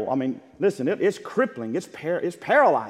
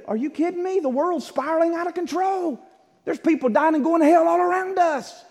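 much higher, urgent disbelief; incredulous preaching; 11 semitones higher than usual. Real, not acted. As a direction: rebuke them, voice climbing high. Preacher rebuking an excuse.

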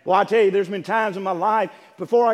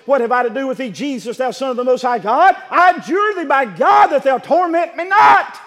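demon's cry; 4 semitones higher, 10 dB louder than usual. Acted. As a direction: cry out in terror of being tormented. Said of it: "he cried and he said".

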